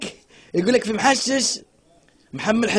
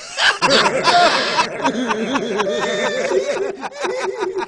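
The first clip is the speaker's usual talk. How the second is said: laughter; 6 semitones higher than usual. Real, not acted. Laughing at joke.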